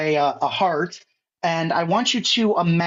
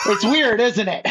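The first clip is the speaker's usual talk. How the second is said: laughing; 5 semitones higher than usual. Real, not acted. Laughing at friend's shock.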